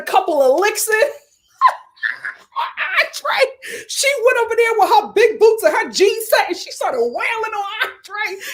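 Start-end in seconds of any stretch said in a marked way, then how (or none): 3.0-8.4 near-tearful laughing excitement; excited high retelling. Real, not acted. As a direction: pitch way up, half crying with glee.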